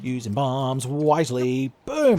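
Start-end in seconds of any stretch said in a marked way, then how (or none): 1.9-2.2 high, loud explosion call; triumphant boom. Pretend. Player detonating bombs without looking, loud boom. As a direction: shout it like a bomb going off. Voices a bomb going off.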